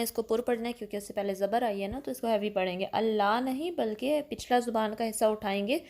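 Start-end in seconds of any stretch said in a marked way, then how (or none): none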